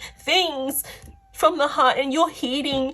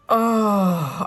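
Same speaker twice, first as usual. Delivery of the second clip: usual speaking voice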